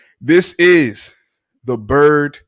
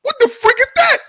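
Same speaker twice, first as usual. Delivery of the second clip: very high, loud squeaky yelp; mock YouTuber; 5 dB louder than usual. Host retelling the YouTuber spotting a dog, high squeak. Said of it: "He's like".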